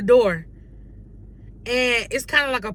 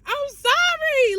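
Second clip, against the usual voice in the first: "I'm sorry" wailed much higher and slow, fake crying; mock wailing sorry; slower than usual. Pretending to beg Papa's forgiveness, hammed-up crying wail.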